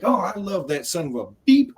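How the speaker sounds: playful buddy cussing; friendly Southern insult. Retold or imitated. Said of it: "in a friendly way, the way Southerners do".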